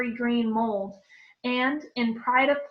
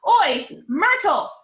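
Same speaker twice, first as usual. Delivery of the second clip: loud, slow, drawn-out bellow; long bellowed call; 8 semitones higher, 8 dB louder, slower than usual. Acted. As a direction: bellow across the room.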